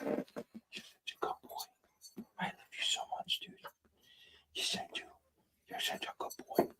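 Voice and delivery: whispered praise, barely audible; tender whisper. Real, not acted. Owner whispers praise to her calming dog, tender whisper. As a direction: hush him to sleep with whispers.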